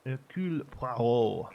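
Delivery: slow, hammed-up French accent; drawn-out French-accented name; Hercule Poirot. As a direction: put on a theatrical French accent. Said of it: "That accent is him to a T".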